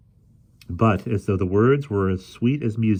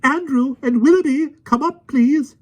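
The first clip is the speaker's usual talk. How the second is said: very high, loud anxious call; 6 dB louder than usual. A narrator's reading. Miss Lark calls her dogs, very high anxious call. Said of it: "she called down anxiously".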